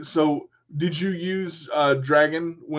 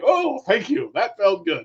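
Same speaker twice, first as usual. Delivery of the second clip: mock relief; 5 semitones higher, 4 dB louder than usual. Joking relief after scratch.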